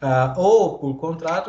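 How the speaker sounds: normal talking voice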